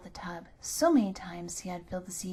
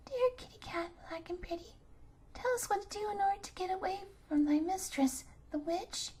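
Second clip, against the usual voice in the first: put-on coaxing children; 10 semitones higher, 4 dB quieter than usual. Children coax the cat.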